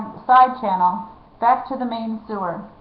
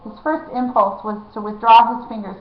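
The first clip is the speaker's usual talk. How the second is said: ordinary delivery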